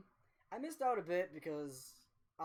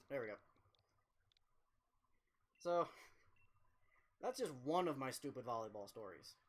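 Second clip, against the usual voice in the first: ordinary talk, nothing marked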